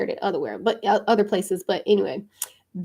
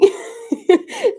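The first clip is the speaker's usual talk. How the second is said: high-pitched giggle; high laugh; 4 dB louder than usual. Real, not acted. Laughing at her own hammed-up cheer. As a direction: laugh at your own bit.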